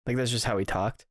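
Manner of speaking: normal talking voice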